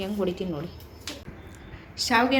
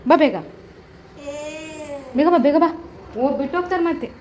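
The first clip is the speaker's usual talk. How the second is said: urgent calling out; 7 semitones higher, 9 dB louder than usual. Real, not acted. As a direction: call him over fast before they leave. Mother urging child to come quickly. (ಜೋರಾಗಿ ಬೇಗ ಬಾ ಎಂದು ಕೂಗುವುದು)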